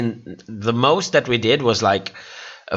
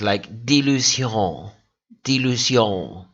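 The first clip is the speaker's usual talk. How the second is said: French accent; 3 semitones lower than usual. Shown as a demonstration. Streamer tries the queen name in a French accent. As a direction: say "Di Lucion" the French way, nasal end. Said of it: "like, with a French accent".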